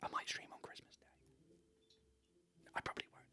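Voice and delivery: hushed secret whisper. Real, not acted. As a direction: whisper it so she can't hear. Hiding plan from partner.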